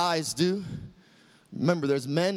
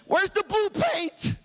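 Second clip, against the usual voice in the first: fired up; 13 semitones higher than usual. Retold or imitated. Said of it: "makes me wanna get up and say".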